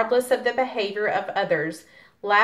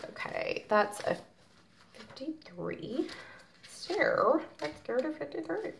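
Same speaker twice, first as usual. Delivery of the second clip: hushed aside; 7 semitones higher, 10 dB quieter than usual. Real, not acted. Muttering aside while finding card.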